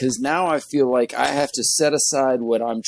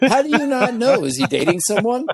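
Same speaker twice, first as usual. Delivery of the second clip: high-pitched mock interrogation; acted disbelief, voice pitched up; incredulous wife; 10 semitones higher than usual. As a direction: grill him like an exasperated wife. Wife grilling husband about his friend.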